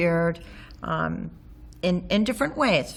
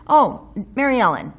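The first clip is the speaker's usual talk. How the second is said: louder, earnest plea of a friend; gently insisting friend, lightly voiced; mild imitation of a friend being serious; 5 semitones higher, 8 dB louder than usual. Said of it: "when he said Mary Ellen, I knew he was very serious".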